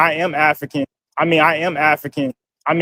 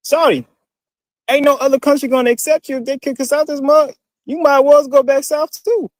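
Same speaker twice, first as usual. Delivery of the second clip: put-on Southern accent, pitched way up; fake Southern accent; 9 semitones higher than usual. Replying to a commenter in a fake Southern accent. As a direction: play it as an overdone Southern accent. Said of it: "fake-ass Southern accents".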